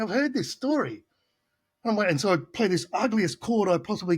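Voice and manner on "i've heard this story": much higher, put-on voice of someone who's heard it all; a mock inner groan at a story told twice; weary mock thought. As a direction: roll your eyes through the words, mocking. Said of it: "but with the gesticulations, everything".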